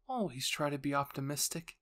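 ordinary delivery, nothing marked

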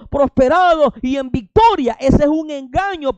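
high, loud fiery sermon; preaching at full force. Acted. Preacher denouncing pastors to his congregation, high and loud. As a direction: thunder it from the pulpit.

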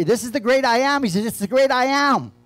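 loud, high proclamation; pastor's rising cry of the great I am; preaching at full force. Acted. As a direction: ring out the great I am.

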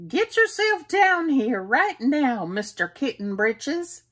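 loud, high, bossy mother calling upstairs; Mama Junebug Jones yelling for her kitten; scolding mother. Acted. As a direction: order him down like a cross mama cat. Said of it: "ordered Mama Junebug Jones".

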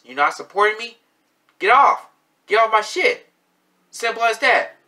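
loud, high furious yelling; angry. Real, not acted. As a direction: shout them off your page. Angry rant at critics.

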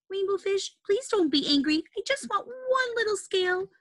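high, sweet begging fish; little fish voice. Acted.

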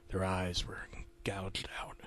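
slow, hushed whisper. A narrator's reading. Narrator describing the corpses, slow eerie whisper. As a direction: breathe the grisly detail. Grisly detail in horror story.

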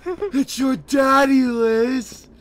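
high, slow, loud shout; mock loud call. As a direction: yell it out hamming the dad. Friend mimics loudly, high.

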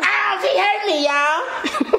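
laughing high fake whine; mock ouch. Wife whining to viewers that he hit her, laughing. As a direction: ham up the hurt for the viewers.

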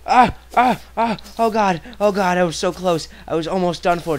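high loud panicked gasps; being chased. Real, not acted. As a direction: gasp out in frantic relief. Relief after near death.

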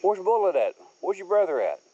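ordinary talk

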